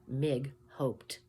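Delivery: usual speaking voice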